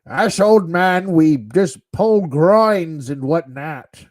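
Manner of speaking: put-on slow, gruff old man voice; old man Sean Connery voice. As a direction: play a creaky old geezer. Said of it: "weird, like, old man Sean Connery awkward voice".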